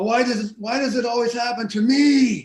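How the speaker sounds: loud, high-pitched exasperated wail; performing his line. Acted. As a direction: belt out a put-upon complaint, stretching the end. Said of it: "share the line with us".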